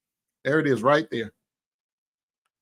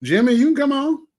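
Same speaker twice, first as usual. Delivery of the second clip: higher and louder, rising on can; calling out; 11 semitones higher, 5 dB louder than usual. Real, not acted. Calling Jimmy up to join the live stream, raised and welcoming. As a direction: call Jimmy up onto the stream.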